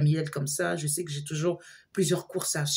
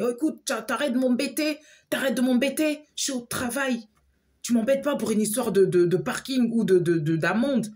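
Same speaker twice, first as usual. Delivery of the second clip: retold exasperated snap, much higher; irritated brush-off; 7 semitones higher, 3 dB louder than usual. Friend at work annoyed by her calls about fines, exasperated. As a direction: push her away, fed up.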